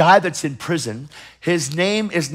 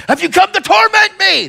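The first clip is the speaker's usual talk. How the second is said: much higher and louder, a shrill demon cry; high panicked demon shriek; 7 dB louder than usual. Retold or imitated. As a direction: scream it as the tormented demon. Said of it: "This demon says".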